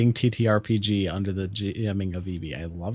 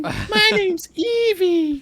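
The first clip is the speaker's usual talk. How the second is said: squeaky character voice; 5 dB louder, much slower than usual. Acted. Joking character voice intro.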